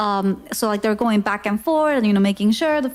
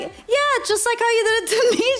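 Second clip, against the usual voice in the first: high, fast teasing reply; excited; 10 semitones higher, faster than usual. Retold or imitated. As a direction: tease him back brightly. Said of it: "And I was like".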